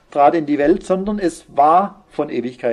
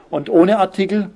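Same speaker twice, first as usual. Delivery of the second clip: ordinary delivery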